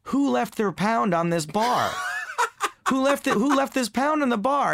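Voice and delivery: high, laughing call across the bar; shouted, giggly who-left-this call; confused bartender shouting. Retold or imitated. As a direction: bellow it out like a baffled barmaid laughing at the pound. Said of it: "and she goes".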